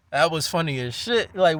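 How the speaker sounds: usual speaking voice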